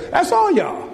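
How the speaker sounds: very high-pitched playful jab with a laugh; teasing. Real, not acted. Preacher tells congregation they are the murmurers, teasing. Teases congregation as the biblical crowd.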